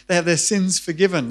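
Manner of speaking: ordinary delivery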